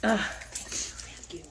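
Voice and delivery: slow, whispered thank you; hushed, tearful gratitude. Real, not acted. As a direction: murmur thanks while composing yourself. Nose running, tissues.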